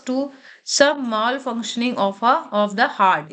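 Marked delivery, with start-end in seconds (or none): none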